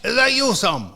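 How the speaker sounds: high-pitched mock Scottish accent; Gary Todd. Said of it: "He's Scottish".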